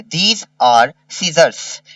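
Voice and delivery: These are scissors, drawn out word by word; very slow dictation. Shown as a demonstration. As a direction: dictate slowly to children. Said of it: "slow dictation read".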